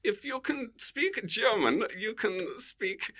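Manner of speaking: slow, high mock-German delivery; high-pitched, deliberate German accent. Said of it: "another accent I was always very good at".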